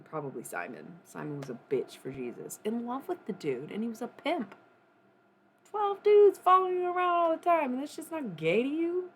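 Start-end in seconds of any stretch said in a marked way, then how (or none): 5.7-9.1 high, loud disbelief; incredulous mocking outburst. Real, not acted. Ranting to viewers about Jesus, loud incredulous. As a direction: scoff at the idea, loud and high.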